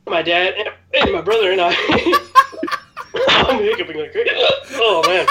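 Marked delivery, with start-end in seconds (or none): 1.8-3.2 high burst of laughter; helpless laugh. Real, not acted. Laughing after burping from pepper. 3.3-5.3 laughing. Real, not acted. Laughing through hot pepper pain.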